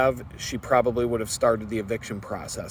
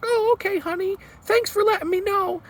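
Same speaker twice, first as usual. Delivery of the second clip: much higher, sugary sweet landlady voice; super friendly landlady. Retold or imitated. Landlady on the phone, suddenly sweet to her tenant, sugary high coo. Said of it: "she's all super friendly".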